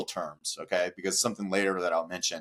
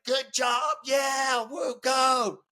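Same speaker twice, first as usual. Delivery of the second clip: mock cheering; 3 dB louder than usual. Drivers cheering from cars.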